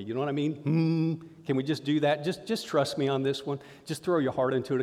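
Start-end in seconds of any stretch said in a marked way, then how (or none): 0.5-1.1 short hum. Shown as a demonstration. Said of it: "I want you to hum with me".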